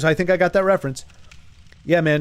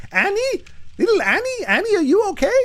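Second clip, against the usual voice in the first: much higher, mock pop-star falsetto; high pop-song falsetto. Streamer jokingly quotes a pop song in high falsetto. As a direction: croon it high like a pop hook.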